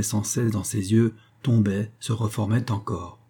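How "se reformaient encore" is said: ordinary delivery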